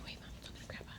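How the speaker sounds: hushed whisper; mother hushing her daughter during a call, whispering. Retold or imitated. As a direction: whisper it so the phone call isn't disturbed. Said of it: "And I said".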